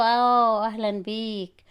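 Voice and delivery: long drawn-out, slow mock greeting; sarcastic welcome. Friend hearing of another pregnancy, slow sarcastic welcome. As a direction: stretch the words out in mock welcome.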